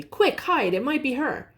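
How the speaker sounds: high, urgent warning; sudden alarm. A narrator's reading. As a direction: hurry them into hiding. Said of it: "cried Lucy suddenly".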